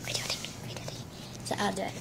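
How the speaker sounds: hushed whispered aside; whispering. Real, not acted. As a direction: whisper it off-camera.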